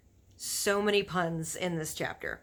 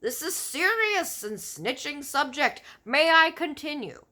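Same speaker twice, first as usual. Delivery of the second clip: scolding giant; 8 semitones higher, 6 dB louder than usual. A narrator's reading. Giant keeps scolding loudly.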